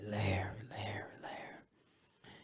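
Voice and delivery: imitating a grandma, fading almost to a whisper; very soft repeated name, trailing away; hushed distress. Grandma struggling to break bad news by phone, hushed.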